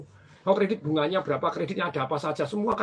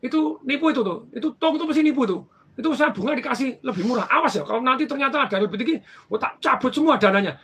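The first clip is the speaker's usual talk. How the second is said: higher, louder mock outrage; angry accusing; 6 semitones higher, 5 dB louder than usual. Aunt angrily accusing the manager of cheating. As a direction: rant like a suspicious rich aunt. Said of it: "dia marah-marah".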